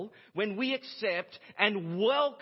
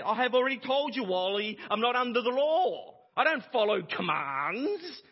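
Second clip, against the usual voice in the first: a complaining churchgoer, louder and higher; mock whiny objection; 5 semitones higher, 5 dB louder than usual. Imagined congregant whining back at the pastor, mock complaint. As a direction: whine back at the preacher in mock protest. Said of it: "before we begin to whine again and say".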